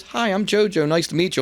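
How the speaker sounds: high, friendly imitation of a sweetheart; JoJo. Ring announcer greeting a nervous newcomer, high and sweet. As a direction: lift the pitch into a sweet, welcoming greeting. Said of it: "who's such a sweetheart".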